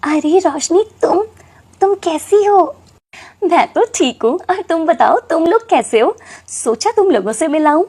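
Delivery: glad, lifted chatter between friends; happy greeting. Acted. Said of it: "तो वो बहुत खुश होती है".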